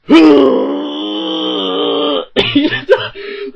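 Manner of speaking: long, loud high strained groan; Frank Frankson. Retold or imitated. Mocking the actor's screaming in pain, strained groan. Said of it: "he sounds like he's in pain".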